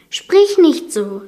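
high, slow, emotional plea; holding back tears. A narrator's reading. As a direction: plead slowly, fighting tears. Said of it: "unterdrückte ihre Tränen".

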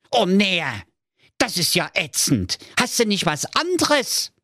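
put-on high-pitched fretful young prince; a pampered prince in a panic; whiny high prince. Prince whines. (hohe, weinerliche Prinzenstimme)

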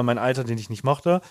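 ordinary talk, nothing marked

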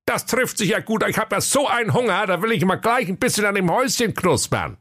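high-pitched grumbling sleeper-car conductor; comic high fool griping; high sulky conductor. Acted. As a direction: whine about the job in a high silly voice. Schwerthelm voice.

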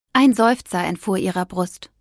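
usual speaking voice

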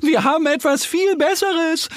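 high, squealing piglet voice; piglets calling out. A narrator's reading. Piglets boast to the bear and duck, squealing call. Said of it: "rufen sie".

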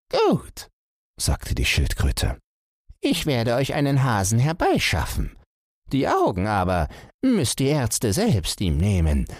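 high little tortoise voice; lightly voiced old tortoise. Tortoise speaks to fish king.

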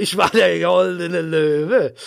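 slow, grand and drawn out; proud declaration. Pretend. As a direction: declaim it slowly like a lion. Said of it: "ich möchte es nochmal sagen".

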